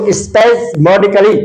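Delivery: spasmodically said slowly and clearly, each syllable separate; slow pronunciation demo. Shown as a demonstration. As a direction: spell spasmodically out slowly for the class. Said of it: "spasmodically का अर्थ है". (spasmodically को धीरे धीरे, हर अक्षर अलग बोलकर)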